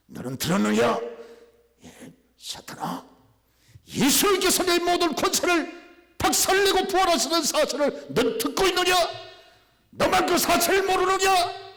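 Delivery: very high, loud challenge to Satan; loud rebuke. Retold or imitated. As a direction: shout it up the mountain, slow at the end. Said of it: "제가 큰 소리 빡빡 치고". (사탄에게 크게 호통치는 목소리)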